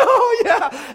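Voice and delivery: loud high burst of laughter; laughing shout. Real, not acted. Preacher laughing at his own story, loud.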